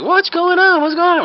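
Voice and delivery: very high-pitched alarm; panicky onlookers. Acted. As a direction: play a crowd fretting in mock panic. Onlookers crowd round in panic.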